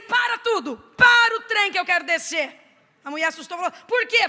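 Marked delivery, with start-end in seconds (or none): 0.0-2.6 high, loud mock outcry of alarm; shocked outcry. Pastor cries out in loud mock shock at a claim of no sin. 3.9-4.3 high, loud startled question; startled why. Retold or imitated. The church woman asks why, loud and startled.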